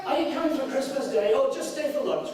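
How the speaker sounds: a light mock of a distant father; breezy polite dad. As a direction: brush them off with cheerful politeness. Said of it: "just say".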